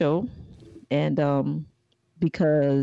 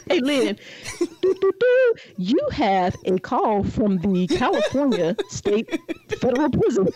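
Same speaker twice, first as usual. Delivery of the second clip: automated jail call voice; prison phone recording; 5 semitones higher than usual. Retold or imitated. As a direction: read it like a recorded operator.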